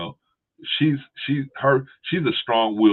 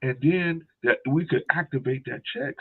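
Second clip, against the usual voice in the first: mock scammer; 6 semitones higher than usual. Scammer pitch.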